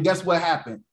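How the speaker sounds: ordinary talk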